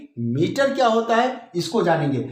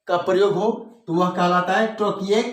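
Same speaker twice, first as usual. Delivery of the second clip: usual speaking voice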